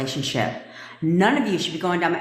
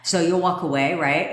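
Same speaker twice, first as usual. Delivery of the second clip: normal talking voice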